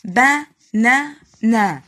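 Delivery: "banana" as BA - NA - NA, loud and slow; teacher sounding out "banana" syllable by syllable; three separate syllables. Shown as a demonstration. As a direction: break "banana" into three even, loud beats. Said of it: "في كلمة بنطقها على ثلاث مرات".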